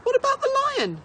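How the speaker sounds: put-on a boy begging in panic; frantic child's question; desperate cry. A narrator's reading. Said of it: "he cried".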